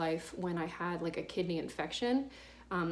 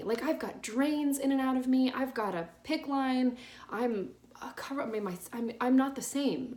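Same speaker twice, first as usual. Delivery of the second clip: raised, louder, mildly exasperated pleading; 5 semitones higher, 3 dB louder than usual. Retold or imitated. Patient replaying her response to the apologizing doctor, exasperated. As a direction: protest it as if helplessly answering back.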